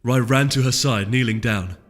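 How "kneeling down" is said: usual speaking voice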